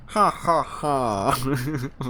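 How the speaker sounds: very slow ha ha ha, each beat spaced; a deliberate mock villain laugh; hammed-up slow evil laugh. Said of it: "evil laugh".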